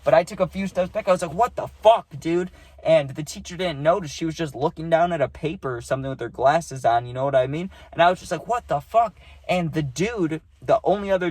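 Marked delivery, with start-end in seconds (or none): 1.3-2.5 higher, louder startled cry of disbelief; shocked outburst. Retold or imitated. Retold reaction to being hit. 8.5-9.2 high, startled exclamation; stunned outburst. Retold or imitated. Retold reaction, still stunned.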